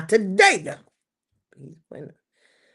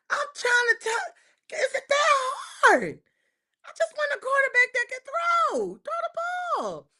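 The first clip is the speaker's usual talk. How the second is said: exasperated wail; 12 semitones higher than usual. Real, not acted. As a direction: beg them to just throw it. Exasperated high crying shout.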